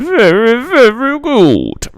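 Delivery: very high and very loud, slowly stretched; booming drawn-out praise. Pretend. Streamer hyping his rugby stream, booming high drawn-out praise. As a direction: belt "Very, very good" like a big hype call, dragging it out. Same catchphrase, second take.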